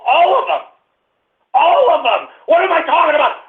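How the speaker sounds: high-pitched emphatic outburst; ranting yell. Real, not acted. Host correcting his own claim, ranting yell. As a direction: blow up while correcting yourself. Rant escalates, corrects himself.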